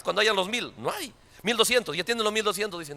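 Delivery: usual speaking voice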